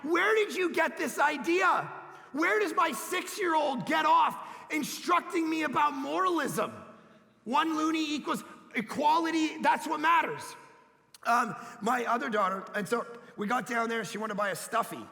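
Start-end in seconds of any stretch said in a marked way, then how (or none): 0.0-10.4 indignant dad. Retold or imitated. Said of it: "I'm like".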